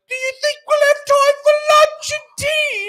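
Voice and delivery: squeaky, singsong British hobbit; Pippin. Acted. Hobbit wondering about a meal, piercing mock Pippin. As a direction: pipe it very high and loud, mock-chirpy. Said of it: "then Pippin will be like".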